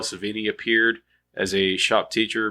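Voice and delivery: ordinary talk, nothing marked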